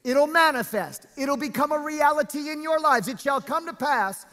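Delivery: high, forceful scripture preaching, drawn-out key words. Real, not acted. Preacher reads God's promise to congregation, preaching. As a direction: preach the verse out with full weight.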